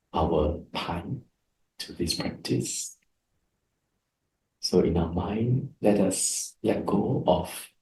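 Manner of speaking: soft guided meditation voice. Acted. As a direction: ease the group inward, hushed and unhurried. Starts guiding the meditation.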